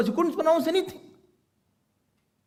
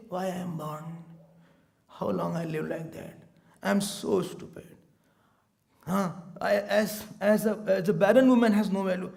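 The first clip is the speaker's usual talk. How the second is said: quiet lament; 6 semitones lower, 4 dB quieter than usual. Retold or imitated. Boy laments his worthlessness alone.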